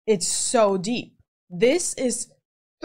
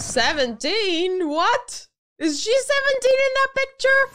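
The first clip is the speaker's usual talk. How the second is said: incredulous questions climbing much higher; stunned disbelief; 10 semitones higher than usual. Real, not acted. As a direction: let the voice soar in astonishment. Stunned by the woman's age.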